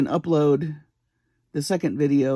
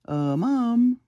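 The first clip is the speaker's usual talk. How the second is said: calm, a bit louder; 7 semitones higher than usual. Retold or imitated. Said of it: "a little louder, but I didn't want her to panic".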